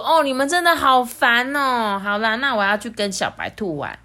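a light annoyed whine from the princess; protesting. Acted. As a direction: complain that they are so annoying. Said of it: "艾莉诺就抗议说".

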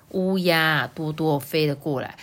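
ordinary delivery

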